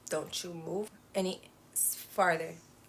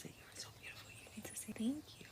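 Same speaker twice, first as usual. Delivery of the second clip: whispered reminder; 2 semitones higher, 15 dB quieter, faster than usual. Real, not acted. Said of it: "teaching child manners, whispered".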